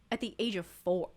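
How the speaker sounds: ordinary talk, nothing marked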